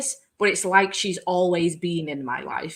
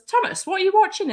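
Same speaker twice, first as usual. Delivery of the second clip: high, louder call from the kitchen; 12 semitones higher, 5 dB louder, faster than usual. Retold or imitated. Brother calling to his son from the kitchen, raised curious call. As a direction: call out to the next room, curious. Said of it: "he goes".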